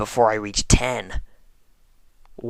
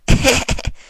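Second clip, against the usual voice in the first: sudden high unvoiced giggle; high laugh; 12 semitones higher, 5 dB louder than usual. Narrator breaking into a giggle. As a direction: burst into a high breathy giggle.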